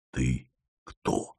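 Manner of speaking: тихий шёпот, выше обычного; спроси шёпотом, с опаской. A narrator's reading. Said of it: "спросил он почему-то шёпотом". (шёпотом, осторожно подойдя к Лёлику)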